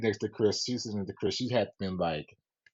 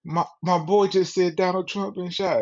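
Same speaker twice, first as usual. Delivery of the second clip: put-on higher, louder excited kid; kid's insistent voice; 8 semitones higher, 3 dB louder than usual. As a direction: play the son pushing back, pitched up. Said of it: "And he like".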